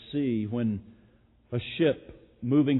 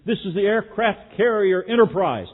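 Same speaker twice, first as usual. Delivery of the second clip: captain losing patience, loud and raised; angry snapping; 7 semitones higher, 11 dB louder than usual. Retold or imitated. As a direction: snap it back with fury, slowing on the order. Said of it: "snapped back with obvious anger and frustration".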